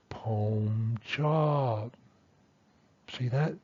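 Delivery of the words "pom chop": "pom chop" joined in one drawn-out glide; co-articulated tones. Shown as a demonstration. Teacher shows co-articulation, "pom chop" smoothly connected. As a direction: run "pom" straight into "chop" without a break. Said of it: "they're going to be connected".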